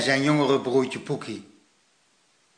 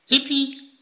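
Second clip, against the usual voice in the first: little echoing cheer; 10 semitones higher than usual. A narrator's reading. Little Poekie copying his brother's cheer, excited cry. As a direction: echo the cheer as the smaller child. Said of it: "riep Poekie".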